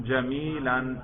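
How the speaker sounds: جمیلاً pronounced "jamilan"; accusative -an ending. Shown as a demonstration. Teacher modelling the accusative for a student, final -an sounded. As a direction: finish جمیلاً with an audible -an. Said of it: "نصب پہ ذرا تلفظ کیجئے".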